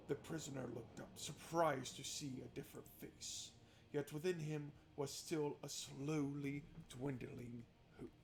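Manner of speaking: quiet, measured storytelling voice; calm narration of a prison scene; story narration. A narrator's reading. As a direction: read the scene like a novel's narrator. Reads visual novel narration.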